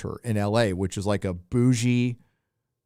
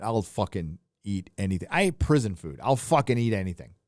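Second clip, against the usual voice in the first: ordinary talk, nothing marked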